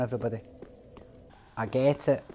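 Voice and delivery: usual speaking voice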